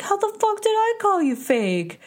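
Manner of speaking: high, incredulous mock yell; Pauly angry. Denying J Wow's accusation, mock angry and high-pitched. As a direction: imitate a man outraged at a false accusation. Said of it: "the angriest you ever see him get".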